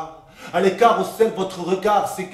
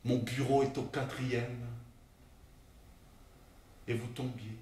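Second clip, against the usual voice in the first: very soft and low, long pauses; hushed, grave reveal; 6 semitones lower, 16 dB quieter than usual. Acted. Colleague revealing the fall past the window, hushed. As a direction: place each last phrase quietly.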